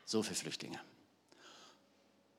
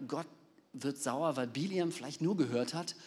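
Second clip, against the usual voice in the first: usual speaking voice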